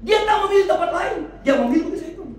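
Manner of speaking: loud, high-pitched sermon delivery; impassioned preaching. Real, not acted. Preacher telling congregation of Jesus's struggle in Gethsemane, impassioned preaching. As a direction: shout each phrase out to the congregation, pausing between.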